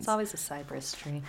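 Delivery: whisper. Real, not acted. Whispering about spooky trees.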